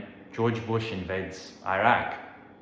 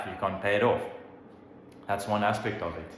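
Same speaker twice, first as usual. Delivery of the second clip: ordinary delivery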